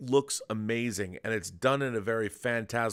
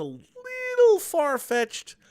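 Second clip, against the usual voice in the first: high, drawn-out squeaky hedge; playful hedging; 7 dB louder, much slower than usual. Real, not acted. Reviewer softening a criticism of the movie, playfully hedging in a high voice. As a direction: stretch it out way up high, louder.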